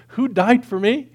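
high, loud, re-enacted astonishment; hammed-up disbelief; incredulous. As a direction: ask it like a baffled teenager. Re-enacts his teenage disbelief at gospel.

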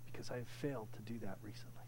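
hushed, ashamed admission; confessional whisper. Real, not acted. Confessing his own failure.

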